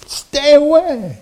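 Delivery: high, drawn-out mock-panicked warning; urgent warning. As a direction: stretch it out high, pleading to keep clear. Acted warning to his dad.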